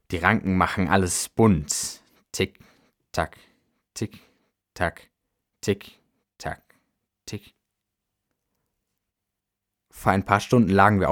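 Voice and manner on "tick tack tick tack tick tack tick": soft, evenly spaced tick-tock; short, quiet, isolated syllables; imitated clock ticking. A narrator's reading. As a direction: tick like a watch in a silent room. Narrator imitates the pocket watch.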